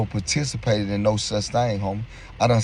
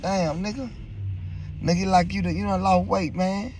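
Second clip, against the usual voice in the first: stunned remark; 8 semitones higher, 3 dB quieter than usual. Retold or imitated. Reacts to friend's weight loss.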